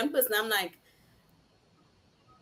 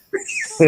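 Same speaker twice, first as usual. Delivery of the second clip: sudden burst of laughter; high squealing laugh; loud high laugh; 6 dB louder than usual. Real, not acted. Laughing at own discovery.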